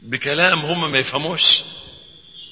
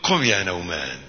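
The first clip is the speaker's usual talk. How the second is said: soft, low affectionate wake-up call; tender teasing; 8 semitones lower, 5 dB quieter than usual. Retold or imitated. The Prophet waking Hudhayfa tenderly, soft teasing. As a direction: wake him gently, like a pet name. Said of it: "هو اللي دلعه الصبح".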